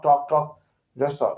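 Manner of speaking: ordinary talk, nothing marked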